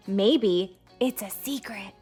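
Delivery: ordinary talk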